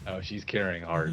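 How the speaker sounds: holding back laughter. Pretend. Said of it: "I can't say that without laughing".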